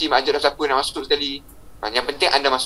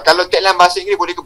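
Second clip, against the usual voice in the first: usual speaking voice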